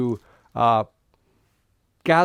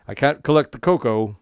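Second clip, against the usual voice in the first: normal talking voice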